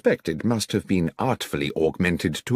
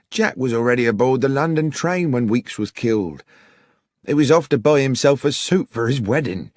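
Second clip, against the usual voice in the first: a higher, pleading father's voice; anxious publican; 7 semitones higher than usual. A narrator's reading. The publican defending his son to Holmes, higher and pleading. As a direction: insist on his son's innocence.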